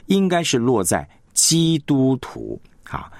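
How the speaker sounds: normal talking voice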